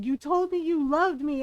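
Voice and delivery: hurt accusation, only half screamed; Llewellyn screaming. Retold or imitated. Llewellyn accusing Solus of false love, mild mock scream. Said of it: "it's basically Llewellyn screaming".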